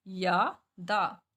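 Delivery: या दा stretched very slowly, syllable by syllable; drawn-out syllables. Shown as a demonstration. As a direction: draw out या and दा slowly. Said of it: "इसमें आधे रै की साउंड".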